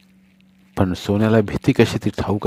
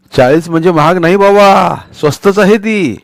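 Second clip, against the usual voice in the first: loud, high-pitched retort, slowing at the end; exclaimed haggling protest; protesting shopkeeper; 7 semitones higher, 6 dB louder than usual. Acted. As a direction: defend your price, loudly and higher. Shopkeeper defends price to haggling boys.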